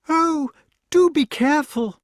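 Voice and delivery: very high, loud female character voice; lady crying out. A narrator's reading. Lady below to the knight in the tree, crying out. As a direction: cry out a high-pitched warning. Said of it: "cried the lady".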